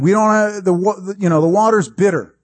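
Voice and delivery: high mock grumble, stumbling at first; complaining. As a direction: imitate a whining crowd. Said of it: "They complain".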